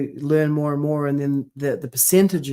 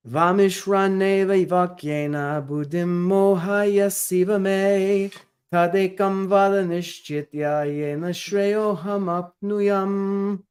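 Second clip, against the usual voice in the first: slow, measured Sanskrit chanting, a bit higher; verse recitation; 5 semitones higher, much slower than usual. Acted. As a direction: intone the whole verse slowly and reverently.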